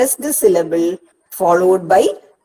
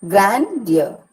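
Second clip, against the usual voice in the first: "Grandeur" with Grand stressed, ear unstressed; stress on first syllable. Shown as a demonstration. Teacher giving a trochee example, "Grandeur" stressed on Grand. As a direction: lean on Grand in "Grandeur", then drop off. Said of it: "Grand is stressed and ear is not".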